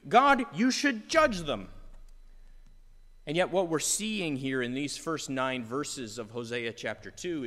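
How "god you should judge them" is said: higher, louder, slowed insistence; emphatic demand. Retold or imitated. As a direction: press the demand hard. Demanding judgment from God.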